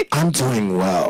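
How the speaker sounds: low-pitched, laughing comeback; mock suave reply. Answering a lover at airport pickup, mock suave. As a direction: drop the voice low for the partner's answer.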